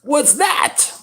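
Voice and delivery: put-on high, wide-eyed little boy voice; a small kid pointing at the sky in wonder; child's voice. Young son asks dad about the sky, high wondering child voice. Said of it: "he's like".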